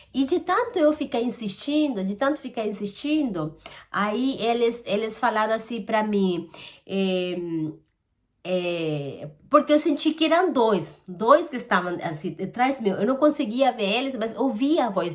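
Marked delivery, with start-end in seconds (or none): none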